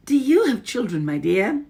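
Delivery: high, falsely sweet witch. A narrator's reading. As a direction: witch feigning politeness. Witch asks grandmother.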